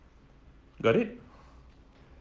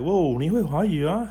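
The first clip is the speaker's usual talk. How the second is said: lightly voiced impressed local, pitched higher; higher-pitched mock surprise at someone's Mandarin; 6 semitones higher than usual. Locals react to a learner speaking Mandarin, mock impressed. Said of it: "They'll talk like that".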